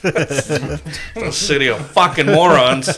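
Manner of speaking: slow, drawn-out jeer through laughter; loud, slow, amused mockery; laughing. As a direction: stretch the insult out, laughing. Mocking Boston talk while laughing.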